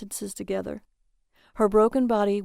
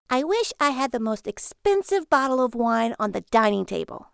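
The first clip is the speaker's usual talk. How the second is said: lightly voiced Lily; 3 semitones higher than usual. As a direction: lightly play Lily making a wish. Lily voiced making wish.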